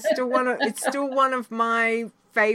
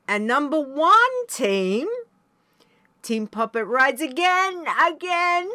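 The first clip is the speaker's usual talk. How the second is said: excited announcement; 6 semitones higher, 4 dB louder than usual. Acted. Announcing the top team.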